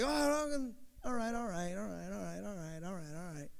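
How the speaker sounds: mock-calming a mad mom, trailing away; placating mom. Preacher voicing a mom backing off from a mad dad, fading. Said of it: "mom's mad, like".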